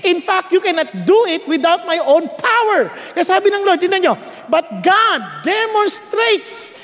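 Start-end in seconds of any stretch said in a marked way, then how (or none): 4.5-6.8 slow, higher, shouted Bible reading; solemn declared verse; scripture proclamation. Acted. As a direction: proclaim the verse over music.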